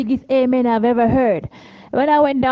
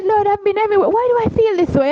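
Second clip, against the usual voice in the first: higher, anxious plea; nervous; 9 semitones higher than usual. Retold or imitated. [name] nervously asks the Lord before the elder, higher anxious. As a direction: pray it with rising nerves. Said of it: "I got nervous".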